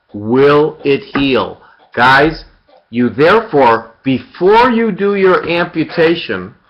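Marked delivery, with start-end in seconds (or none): 0.1-1.6 very slow emphatic question; drawn-out teaching emphasis; slow, deliberate. Shown as a demonstration. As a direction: spell it out slowly for the class. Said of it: "You have to ask yourself".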